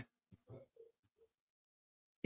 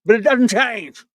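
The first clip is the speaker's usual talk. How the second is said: mocking shout; 8 semitones higher, 7 dB louder than usual.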